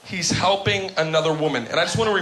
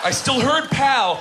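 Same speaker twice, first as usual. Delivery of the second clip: indignant outburst; 8 semitones higher, 3 dB louder, slower than usual. Real, not acted. Indignant retelling of insult.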